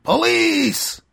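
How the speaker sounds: high, loud gravelly warning shout; gravelly yell. Retold or imitated. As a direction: yell it out to warn the block. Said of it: "gravelly voice yelling out".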